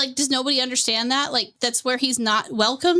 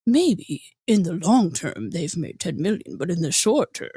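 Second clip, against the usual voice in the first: a lightly voiced lower mock Edwin, slow and evasive; Edwin thing; 6 semitones lower than usual. Retelling Edwin's dodge to the cohost, slow lower mock voice. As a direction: drag it out like a weaselly excuse. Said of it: "he did his little Edwin thing".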